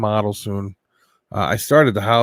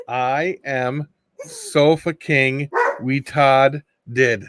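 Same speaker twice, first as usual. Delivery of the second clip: slow deliberate reading; 2 semitones higher, much slower than usual. Acted. Reading a joke phrase aloud.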